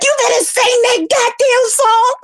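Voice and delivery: high, hyped-up shout of encouragement; excited hype. Retold or imitated. Urging her baby to sing, high and hyped. Cheering performer on.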